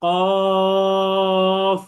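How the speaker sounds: loud drawn-out কফ for the class to repeat; সবাইকে শেখাতে টেনে কফ; model qaf. Shown as a demonstration. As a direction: stretch কফ long and heavy from the tongue root. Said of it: "নিচের ঠোঁটের সাথে লেগে যাবে".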